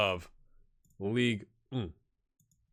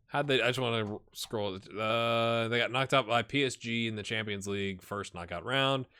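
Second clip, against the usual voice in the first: usual speaking voice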